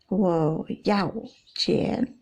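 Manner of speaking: very slow "Wo yao qian", short falling "yao"; nada keempat yao. Shown as a demonstration. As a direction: separate each word of "Wo yao qian". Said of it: "Ini sama ini beda kan". ("Wo yao qian" sangat pelan, terpisah)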